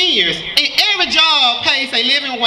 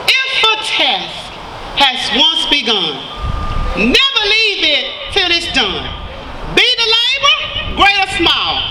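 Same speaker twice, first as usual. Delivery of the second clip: firm teacher's recital. Retold or imitated. Former student quoting her teacher's words to the crowd, firm recital. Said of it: "she was a firm teacher".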